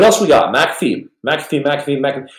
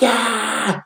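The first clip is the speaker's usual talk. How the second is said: put-on childhood cheer; 10 semitones higher, 4 dB quieter than usual. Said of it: "And we'd be like".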